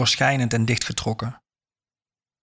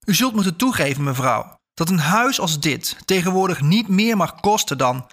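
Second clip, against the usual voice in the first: raised-pitch, insistent objection; indignant protest; 6 semitones higher than usual. A narrator's reading. Buyer haggles with the old house owner, indignant. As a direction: press the point, mildly affronted.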